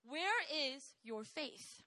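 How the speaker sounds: slow, calm reproach; Jesus calmly questioning his disciples; slow gentle question. Acted. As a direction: ask it slowly and softly. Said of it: "And Jesus responds".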